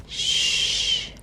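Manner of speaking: soft breathy shh; whispered shush. Acted. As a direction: hush them in a whisper. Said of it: "said Biff".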